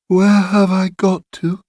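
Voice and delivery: high-pitched, puzzled question; bewildered waking patient. A narrator's reading. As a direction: ask high, lost after waking up. Patient wakes, asks where she is.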